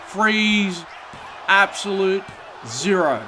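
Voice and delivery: high, loud ecstatic play-by-play; wrestling commentator. Acted. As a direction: erupt as he kicks out. Krillin kicks out, excited call.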